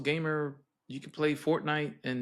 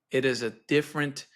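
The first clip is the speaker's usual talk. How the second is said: normal talking voice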